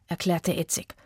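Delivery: ordinary delivery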